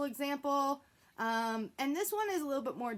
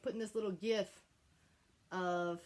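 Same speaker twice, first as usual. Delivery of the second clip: usual speaking voice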